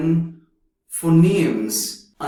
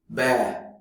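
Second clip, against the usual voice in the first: American bear. Shown as a demonstration. Teacher showing American bear, American accent. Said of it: "Bear in American".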